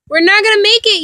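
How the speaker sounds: an understated despairing call; mild cry of despair. A narrator's reading. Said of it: "you cried out".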